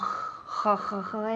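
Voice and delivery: raspy throat fricative, repeated; Spanish jota sound. Shown as a demonstration. Teacher isolating the sound to avoid, raspy jota demo. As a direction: isolate a harsh kh sound several times. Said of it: "ese sonido no".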